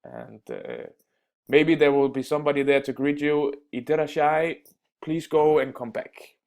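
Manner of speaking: normal talking voice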